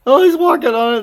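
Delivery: high, loud delighted repeat; excited. Real, not acted. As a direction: shout it again with glee. Bird standing on frozen bowl.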